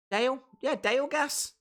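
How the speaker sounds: "Dale" as in the English name, questioning lift; own English pronunciation of Dale. Shown as a demonstration. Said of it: "That's how I would say Dale".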